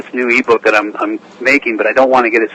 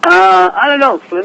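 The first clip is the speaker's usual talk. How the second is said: Slim's voice; 8 semitones higher than usual. Pretend. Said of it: "I wonder if Slim could do an Australian accent".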